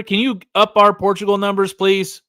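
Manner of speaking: normal talking voice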